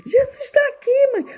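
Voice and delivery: put-on very high child's voice; insistent child.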